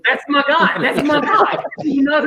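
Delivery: high laughing praise, up in pitch. Real, not acted.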